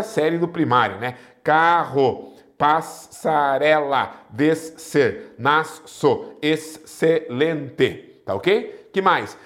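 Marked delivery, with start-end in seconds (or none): 1.4-8.2 "Carro, passarela, descer, nasço, excelente" split into separate syllables. Shown as a demonstration. Teacher dividing digraph words for students, syllable by syllable. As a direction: break each word between the doubled letters, pausing. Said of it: "Separam-se as letras".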